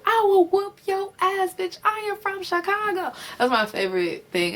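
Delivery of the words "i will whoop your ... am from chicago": Mystic Summers quote. Retold or imitated. Quoting Mystic Summers' famous threat, high and sassy. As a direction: squeal the threat like a reality queen. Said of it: "Mystic Summers, season two".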